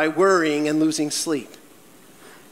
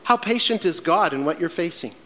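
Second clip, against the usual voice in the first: ordinary talk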